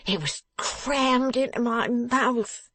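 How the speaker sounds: high, slow, aggrieved wail; dismal. A narrator's reading. Beaten youth explaining to the dressmaker, dismally wailing. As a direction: whine it out, stretching the words. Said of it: "the dismal youth replied".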